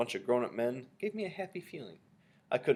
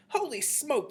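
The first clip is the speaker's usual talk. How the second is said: high-pitched startled cry; yelling; 3 dB louder, slower than usual. A narrator's reading. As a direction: cry out in alarm. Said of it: "the man was yelling".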